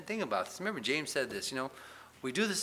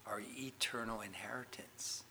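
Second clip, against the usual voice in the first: hushed whisper; 9 dB quieter than usual. Real, not acted. Preacher whispers the promise to his congregation, hushed.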